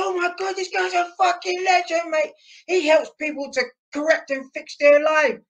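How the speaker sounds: starstruck Cockney fan, very high-pitched; imitated awestruck Cockney bloke; Cockney accent. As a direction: gush in a put-on high Cockney voice. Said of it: "that's how I'm doing a Cockney accent".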